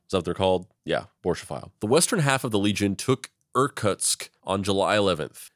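ordinary talk

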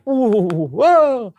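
very high drawn-out whoas; awestruck whoa. Retold or imitated. Two friends marveling at the iPhone, very high whoas. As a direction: marvel out loud together. Both amazed by the phone.

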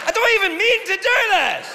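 very high, loud protest; exasperated outcry. Acted. Comic caught offending yet again, high exasperated shout. Exasperated at his own slips.

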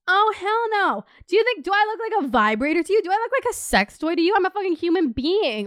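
high, indignant hell no; appalled, throwing it back at him; outraged. Real, not acted.

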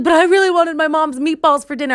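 high, loud mock whine, almost crying; whiny husband. Husband Steve sulking over lost meatballs, in a high mock whine. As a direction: play the husband close to tears over dinner. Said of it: "Steve almost cried".

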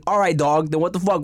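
ordinary talk, nothing marked

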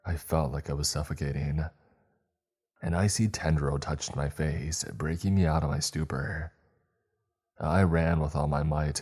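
usual speaking voice

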